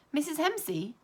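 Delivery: loud, high excited call; cried out. A narrator's reading. Child calling the teacher, loud and high. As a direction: call her name out too loudly. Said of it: "and then I cried out".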